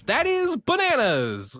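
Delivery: high, slow, amazed exclaim; gleeful cry about the falling death rates; excited. Real, not acted. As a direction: stretch it out in delight. Reacting to good health stats.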